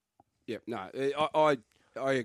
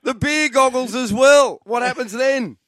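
higher and louder, slow laughing emphasis; building the joke with glee; joking about the staring rule; excited; 10 semitones higher, 10 dB louder than usual. Real, not acted.

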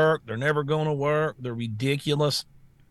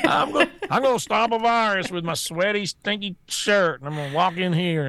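higher laughing mock of a shirt-mask wearer; mocking covid worrier; 4 semitones higher than usual. Host mocking people masking with T-shirts. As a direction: ham up a silly masker, laughing.

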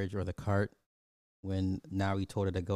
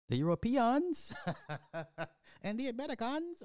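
put-on African accent. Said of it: "John Boyega's, uh, African accent was on point".

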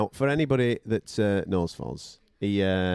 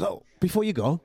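higher, wheedling last-minute ask; sly afterthought; 8 semitones higher than usual. Retold or imitated. The mate stops the host leaving, high and wheedling. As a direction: slip in one more favour, pitched up. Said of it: "And he goes".

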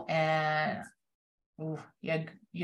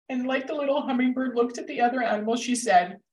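higher, choked-up voice, really moved; emotional; 6 semitones higher, faster than usual. Real, not acted. Likening teachers to the hummingbird, genuinely emotional. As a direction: keep going through the emotion. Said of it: "I get emotional about this".

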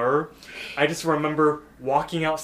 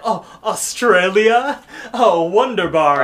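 loud, higher, drawn-out posh gushing; rich people lingo; 6 semitones higher, 11 dB louder, slower than usual. Retold or imitated. LaDonna delighted by Mordecai's origins, posh gushing. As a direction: gush slowly like an affected socialite. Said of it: "weird rich people lingo".

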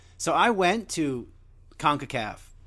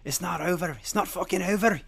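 Conor taunting his rival about his wife, lightly imitated; imitating Conor; 2 semitones higher than usual. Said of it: "Conor's a dick".

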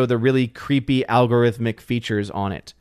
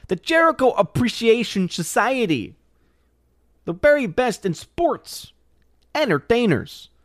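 wrestling announcer; 10 semitones higher than usual. Acted. Mock wrestling promo.

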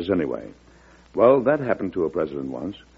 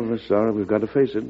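normal talking voice

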